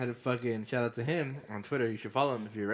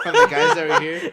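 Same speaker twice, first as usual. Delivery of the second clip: words squeezed through a high burst of laughter; laughing real hard mid-sentence; 16 dB louder than usual. Real, not acted. Laughing at own confession.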